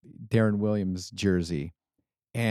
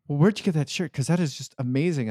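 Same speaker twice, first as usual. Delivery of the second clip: lightly mocked British accent, pitched higher; British guy; 6 semitones higher, faster than usual. Man in England asking about the jersey, hinted British accent. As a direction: imitate a chatty British stranger, amused, British accent. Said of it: "he's like".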